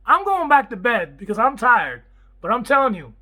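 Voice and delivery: loud, high, animated wrap-up; excited sign-off. Real, not acted. Signing off to viewers, tired but wound up: loud excited. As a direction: hype up the close.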